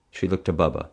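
ordinary delivery

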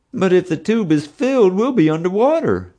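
high, plaintive whimper; mewling. A narrator's reading. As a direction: mewl it like a frightened child. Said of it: "Bubba mewed".

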